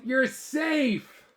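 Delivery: high, loud relieved call; yelled. Acted. Kids shouting to a rescued friend, loud high relief. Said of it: "we yelled".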